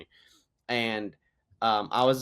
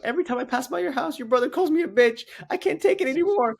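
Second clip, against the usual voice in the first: put-on high, whiny quivering kid voice, much higher; imitating crying kid; 6 dB louder, faster than usual. Retelling to friends how the bullied kid whined, high quivering mock cry. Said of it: "this kid's lips started quivering".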